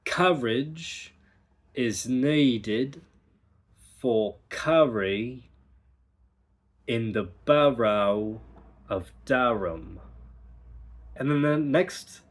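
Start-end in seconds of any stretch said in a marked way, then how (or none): 0.1-10.0 slow, deliberate, R springing into second syllables; practice sentence. Shown as a demonstration. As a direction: model each word slowly. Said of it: "So the practice sentence is".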